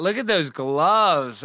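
normal talking voice

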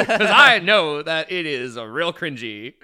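laughing through the words. Real, not acted. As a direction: say it while laughing, slowly. Laughing at own cringe.

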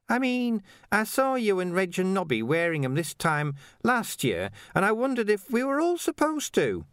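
faltering Ping. Acted. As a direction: trail off unsure. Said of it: "Ping faltered".

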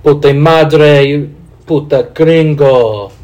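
Spanish. Shown as a demonstration. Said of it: "I can only say bad shit and insults".

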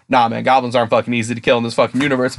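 ordinary talk, nothing marked

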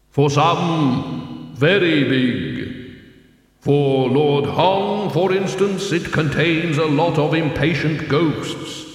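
slow, echoing, solemn intoning; voice of Death. A narrator's reading. Death explains the desert to the dead teacher, solemn. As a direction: draw out each phrase as otherworldly Death.

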